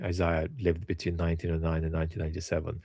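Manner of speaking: ordinary talk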